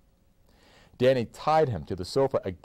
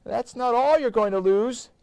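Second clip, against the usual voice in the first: nervous; 6 dB louder than usual. A narrator's reading. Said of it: "Danny said nervously".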